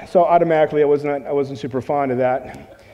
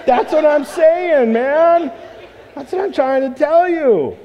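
high and loud, slow and emphatic; excited exclamation; 11 semitones higher, 5 dB louder, slower than usual. Retold or imitated. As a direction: burst out, wide-eyed, confirming it to a friend. Excitedly confirming to a gym friend.

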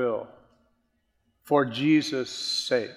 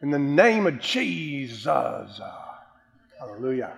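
showy preacher voice. Retold or imitated. Mocking a preacher's put-on authoritative prayer voice. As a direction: intone it like a preacher putting on authority. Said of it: "Boy, that sounds authoritative".

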